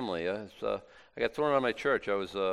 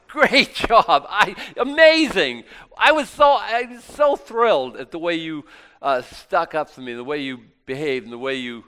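loud, high, gushing congratulation; imagined God bursting with praise, much louder; thrilled praise; 7 semitones higher, 11 dB louder than usual. Acted. God praising a believer on the other side, thrilled. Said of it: "and God says".

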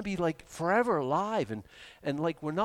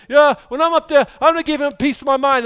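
loud, high, quick mock indignation; hammed-up angry bluster at full volume; defiant bluster; 11 semitones higher, 13 dB louder, faster than usual. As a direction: imitate someone boasting they'll tell God off. Mimics people planning to confront God.

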